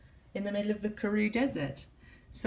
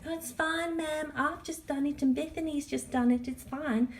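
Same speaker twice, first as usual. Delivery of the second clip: put-on higher little-girl voice, breezy and dismissive; 7 semitones higher than usual. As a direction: mimic the little girls egging him on. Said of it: "these little girls going".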